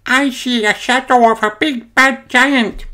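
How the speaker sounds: very high, frightened little panda voice; Peggy alarmed. A narrator's reading. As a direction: warn the others in a high, fearful child-character voice. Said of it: "gasps Peggy".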